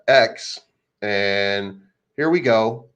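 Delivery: normal talking voice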